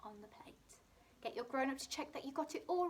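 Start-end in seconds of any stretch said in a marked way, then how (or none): 0.0-0.5 soft whispered reminder. Shown as a demonstration. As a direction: whisper it as they start writing. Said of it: "your turn to write".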